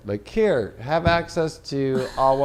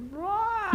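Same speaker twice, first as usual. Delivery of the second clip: mock panic yell; 3 dB quieter than usual. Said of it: "to see people like".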